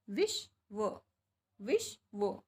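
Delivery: विश्व broken slowly into "vish", then "va"; spelling pronunciation. Shown as a demonstration. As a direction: sound out विश्व in two parts, vish... va. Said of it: "तो विश्व कैसे लिखेंगे?". (विश्व को तोड़कर धीरे बोलें, "विश्... व")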